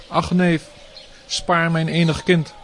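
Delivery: high, loud desperate begging; pleading. Acted. Mother begs her cruel cousin, desperate high plea. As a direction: beg for your only child's life. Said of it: "smeekte Devaki".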